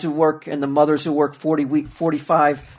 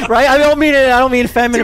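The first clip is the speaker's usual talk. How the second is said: loud; 7 semitones higher, 7 dB louder than usual. Real, not acted. Loud excited rant.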